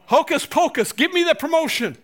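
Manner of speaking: high, sing-song mock spell casting; mock magic incantation. Preacher mocking a wish-spell for a promotion, high mock incantation. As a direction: chant it like a stage magician.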